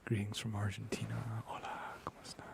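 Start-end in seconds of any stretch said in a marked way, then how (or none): 1.5-2.5 soft whispered Spanish greeting; ASMR whisper greeting. Real, not acted. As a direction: breathe the hello into the mic.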